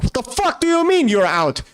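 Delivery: high-pitched indignant outburst; agitated. Acted. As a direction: fire off the complaint way up in pitch, outraged.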